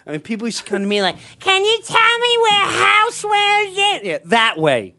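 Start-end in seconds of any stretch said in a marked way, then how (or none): 1.4-4.0 squeaky mock customer asking directions; a customer's high timid question. Customer asking a teenage salesman, in a high mock voice.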